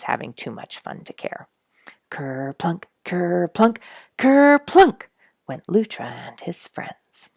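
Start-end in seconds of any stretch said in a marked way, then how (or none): 2.1-5.1 building splashes. A narrator's reading. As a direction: climb to a big splashy finish. Otters splash in duck pond.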